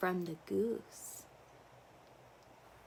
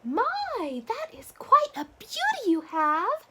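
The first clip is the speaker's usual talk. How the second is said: bird voice; 14 semitones higher, 4 dB louder than usual. Acted. Bird voice across river.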